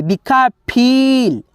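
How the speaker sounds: फील said slowly, the ई held long; drawn-out long ई. Shown as a demonstration. Teacher modelling the long ई in फील, drawn out. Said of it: "खींच रहा है".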